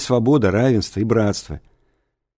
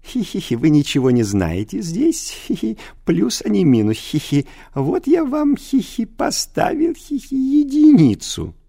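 high, giggly mocking teacher voice; giggling teacher; 11 semitones higher, 3 dB louder than usual. A narrator's reading. Teacher mocking a pupil over a bad mark, high and giggly. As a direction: gloat through constant giggles. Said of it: "Он вечно хихикал".

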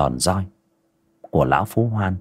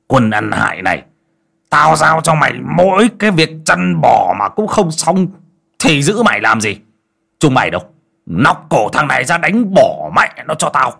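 loud, higher furious orders; hissing rage; 8 semitones higher, 6 dB louder than usual. Acted. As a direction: spit out the orders to beat him. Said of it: "miệng rít lên".

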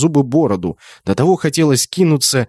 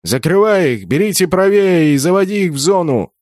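high, urgent orc commander; raised-pitch battle command; shouted orders; 6 semitones higher than usual. A narrator's reading. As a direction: shout instructions to a comrade. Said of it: "крикнул орк магу тени".

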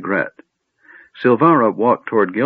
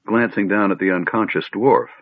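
usual speaking voice

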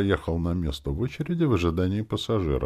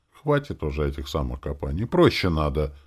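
plain reading voice

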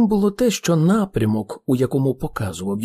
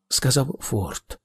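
plain reading voice

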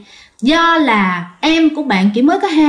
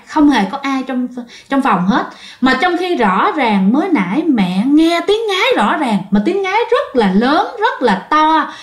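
usual speaking voice

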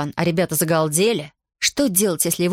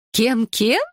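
higher, amused double question; through laughter; 5 semitones higher than usual. A narrator's reading. Teacher asks the boy to repeat, amused. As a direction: ask as if holding back laughter. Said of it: "сквозь смех переспросила она".

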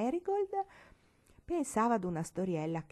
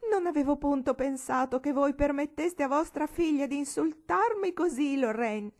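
much higher, louder, tearful scolding wail; hysterical weeping shout; 9 semitones higher, 6 dB louder than usual. A narrator's reading. Old lady accusing Lorraine, hysterical tearful shout. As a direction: rail at the mother through tears. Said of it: "Piangendo, la vecchia signora gridava".

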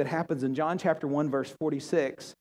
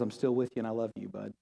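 soft, low, tender voice of comfort; quiet loving reassurance, close and hushed; gentle reassurance; 7 semitones lower, 8 dB quieter, faster than usual. Acted. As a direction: speak like a father calming his child. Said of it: "And God says".

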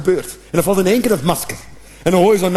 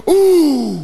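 high groaning oeh, laughing; grunt of a missed chance; 8 semitones higher than usual. Retold or imitated. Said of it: "je hoort". (kreunende oeh bij een gemiste kans)

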